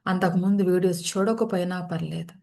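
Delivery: unhurried phrases with long settling pauses; guided meditation. Real, not acted. Leads guided yoga nidra practice.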